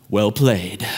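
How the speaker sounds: low, measured retold concession; low-voiced, dry acknowledgment; grudging concession. Retold conceding to wife after stare-down.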